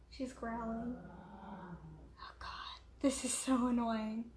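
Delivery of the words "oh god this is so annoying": whispered exasperation; annoyed whisper. Real, not acted. Vlogger whispering about her growling dog, annoyed. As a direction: whisper it under your breath, fed up.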